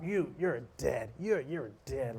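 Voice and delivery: slow, deliberate mock threat; mild drunken threat. Drunk man threatens each player, mild slow menace. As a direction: lightly act a slow death threat. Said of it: "he's just calling everyone up".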